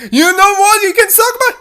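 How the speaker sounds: very high, loud squeaky insult; angry princess. Acted. Dee Dee mouthing off to the owner, high and loud.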